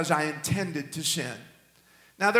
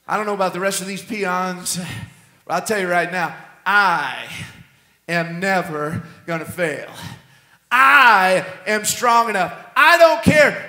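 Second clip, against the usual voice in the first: strut the words like a proud man; swaggering Peter; 4 dB louder than usual. Retold or imitated. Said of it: "you can almost hear the swagger in his voice".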